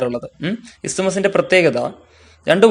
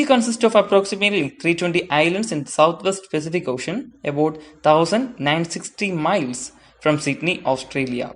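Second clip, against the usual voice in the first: slow, careful read-aloud of English text; textbook reading; 3 dB quieter, much slower than usual. Acted. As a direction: read each phrase out slowly for students. Reads English textbook passage aloud.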